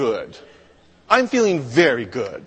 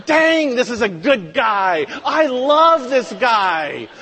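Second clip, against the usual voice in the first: hammed-up high, loud admiration; excited praise, stretched at the end; delighted God; 7 semitones higher, 3 dB louder than usual. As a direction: gush with mock-divine enthusiasm. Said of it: "God is saying".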